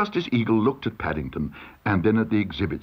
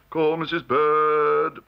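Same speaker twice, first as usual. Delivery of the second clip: court calling out; 6 semitones higher, much slower than usual. A narrator's reading. Second usher relays call for Missus Bird, calling out. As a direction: relay the call down the line, drawn out.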